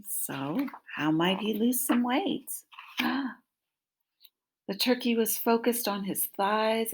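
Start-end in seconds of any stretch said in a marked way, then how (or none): 2.8-3.3 sharp dramatic gasp. Acted. Reading to children, a sharp mock gasp. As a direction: gasp in mock alarm for the kids. Reader gasps at turkey's fear.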